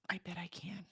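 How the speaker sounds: a breathy whisper, as if to herself. Real, not acted. Reassuring herself about the walk, in a whisper. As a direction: whisper the promise under her breath. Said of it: "I bet, I bet I can do that".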